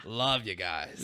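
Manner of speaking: loud mock Australian greeting; hammed-up Aussie hello to fans; Australian accent. Podcast host doing an Australian accent for listeners. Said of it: "That's my best attempt at an accent".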